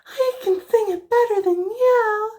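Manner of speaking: high, snotty mimic of the rival girl; mocking a snooty girl. Mocks the rival girl bragging she sings better. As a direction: sneer it in a squeaky bratty voice. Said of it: "she's like".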